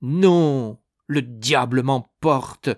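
loud, drawn-out oath; angry refusal. A narrator's reading. Dying man refuses confession.

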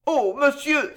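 high, grieving protest; Juana's pained dignity. A narrator's reading. Juana rebukes the chevalier, high and pained. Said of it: "sur un ton de dignité déconcertant".

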